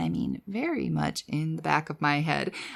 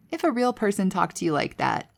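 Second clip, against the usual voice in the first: ordinary talk, nothing marked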